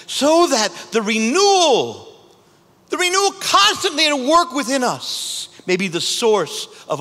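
imitating a priest chanting the collect; priest praying. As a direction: intone it like the priest at the altar. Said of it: "so the priest is praying".

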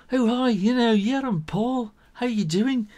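bright high cheery voice; a friendly beetle saying hi; cheery Paul. Pretend. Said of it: "very much more sort of".

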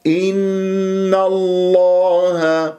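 إن الله chanted high and slow, heavy open a; heavy lam, unrounded. Shown as a demonstration. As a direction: hold the nasal, then الله with unrounded lips. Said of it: "بهذه الطريقة". (إن الله بتفخيم دون تكوير الشفاه)

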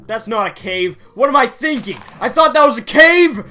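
loud, high frustrated yelling; real exasperated shouting; angry outburst. Real, not acted. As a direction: explode in annoyance at yourself. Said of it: "Why am I getting so angry".

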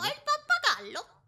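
mocking parrot. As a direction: echo him to annoy. Girl parrots him. (imitazione beffarda)

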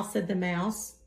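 usual speaking voice

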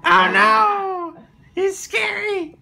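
high squeal of fake fear; mock fright. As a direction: shriek in playful terror. Mock fright at monster suit.

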